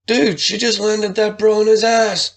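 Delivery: high excited bro voice; bro commentary. Acted. Chad hyping the fight to the bros, high and excited. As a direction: gush like a fan watching a fight. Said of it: "Chad offered commentary".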